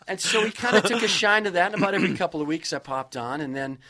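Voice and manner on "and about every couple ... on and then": ordinary talk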